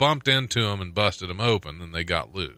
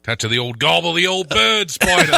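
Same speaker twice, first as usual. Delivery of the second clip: mock British accent; 7 semitones higher, 4 dB louder than usual. Hamming the British bit.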